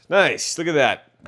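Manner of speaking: excited. Real, not acted. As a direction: cheer the cut. Admiring a clean cut.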